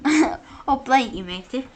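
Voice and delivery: friend echoing the joke in a put-on English accent; loud, jokey fake English accent; bad English accent. Laughing friend copies the bad English accent. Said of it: "this really bad accent".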